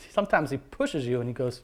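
ordinary talk, nothing marked

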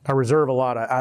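ordinary talk, nothing marked

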